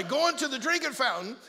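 ordinary talk

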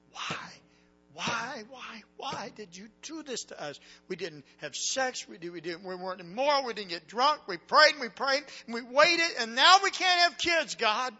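rising to a high, loud, raw shout at God; anguished cry to God. Retold or imitated. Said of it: "I hit the steering wheel and said this".

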